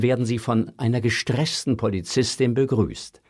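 ordinary delivery

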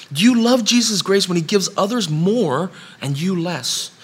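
impassioned preacher's crescendo; preaching at full force. Real, not acted. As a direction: build the sermon to its climax. Preaching builds to climax.

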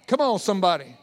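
ordinary talk, nothing marked

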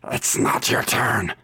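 hoarse, rasping reprimand; scolding witch. Acted. Witch tells companion to wait, raspy scolding. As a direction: scold in a harsh whispery croak. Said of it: "the voice go".

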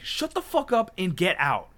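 loud, high retold yell; re-enacted angry outburst; losing control. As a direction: shout him out of the shop. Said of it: "lost control".

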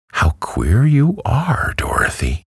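puzzled hen, pitched high then dropping. A narrator's reading. Hen finds Dorothy's objection odd, mildly puzzled. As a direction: marvel at her oddness, bemused. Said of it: "in a puzzled tone".

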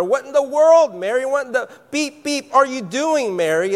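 disciples yelling at Mary, half-acted; mock indignant yell. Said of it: "They were yelling at her".

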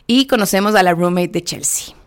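normal talking voice

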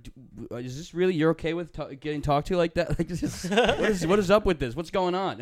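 put-on outraged teen protest; incredulous. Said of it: "I'd be like".

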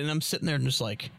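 usual speaking voice

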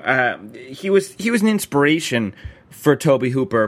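usual speaking voice

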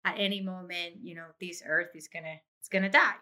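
ordinary delivery

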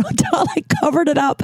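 usual speaking voice

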